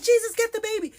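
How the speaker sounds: high, loud Trini alarm cry; elders screaming. Retold or imitated. Older women in the backyard yelling up at the window, high loud Trini shriek. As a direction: shriek it in a Trini accent as the baby dangles. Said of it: "They were just like".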